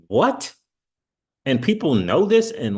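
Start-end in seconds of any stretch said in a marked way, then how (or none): 0.1-0.5 high rising disbelieving what; incredulous what. Retold or imitated. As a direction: pitch it up in shock.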